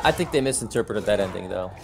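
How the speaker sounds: ordinary delivery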